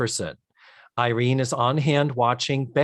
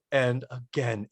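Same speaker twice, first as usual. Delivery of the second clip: ordinary talk, nothing marked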